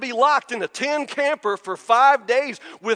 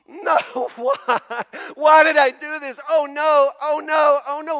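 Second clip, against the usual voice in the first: high, louder hammed-up wail; a parody of a moan, higher and louder; mock wailing mother-in-law; 5 semitones higher, 5 dB louder than usual. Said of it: "screaming and wailing".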